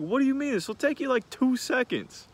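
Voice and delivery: mock the client's outraged disbelief; indignant client. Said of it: "And they're like".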